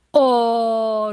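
long drawn-out "oh", mildly called; the children's protest, lightly voiced and held long. A narrator's reading. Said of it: "rufen die drei Kinder".

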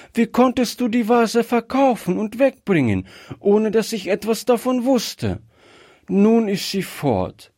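put-on high, reproachful little-girl voice; child close to tears, accusing; upset child. A narrator's reading. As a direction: protest high, building in distress. Acted upset child protesting to grandfather.

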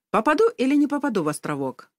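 high, quick, perky question; playful challenge. A narrator's reading. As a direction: ask it as a cheeky dare. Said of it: "задорно спросил я".

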